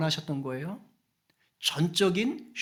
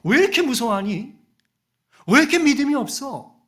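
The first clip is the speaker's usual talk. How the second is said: loud, higher-pitched acted scolding; exasperated, raised-voice questioning; scolding rebuke; 7 semitones higher, 7 dB louder than usual. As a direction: chide them forcefully for their doubt. Acts out Jesus scolding the disciples.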